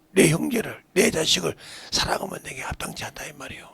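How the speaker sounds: usual speaking voice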